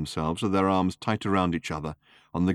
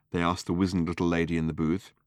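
ordinary delivery

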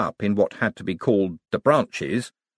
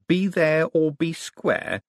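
slow, higher-pitched questioning repeat; incredulous echo; 8 semitones higher, slower than usual. Acted. Visitor querying the wizard's slogan, incredulous. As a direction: repeat it slowly in disbelief.

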